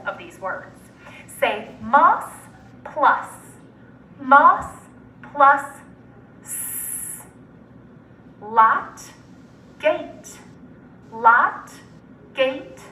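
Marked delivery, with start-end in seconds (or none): 6.4-7.2 a long voiceless "s" hiss; just the sound of s, no vowel; isolated final sound. Shown as a demonstration. Teacher giving students the last sound, isolated s. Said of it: "the final or last sound".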